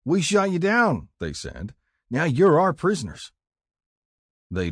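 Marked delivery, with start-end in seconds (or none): 0.1-1.1 high, loud playground crowing; older boys' taunt. Acted. Older boys taunting captives in game. 2.1-3.3 high, loud playground crowing; older boys' taunt. Acted. Older boys claiming prisoners in game.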